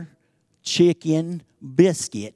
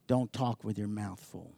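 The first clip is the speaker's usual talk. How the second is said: low, soft imitation of a grandmother; lightly voiced grandmother's quiet reminder; grandmother's admonition; 7 semitones lower, 11 dB quieter than usual. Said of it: "your grandmother says to you".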